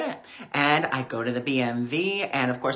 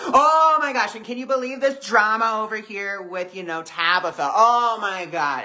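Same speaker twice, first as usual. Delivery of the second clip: high, loud gossipy burst; loud gabbing friend; 5 semitones higher, 3 dB louder than usual. Acted. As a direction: gush like the boisterous friend hogging the couch. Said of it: "talking very loud".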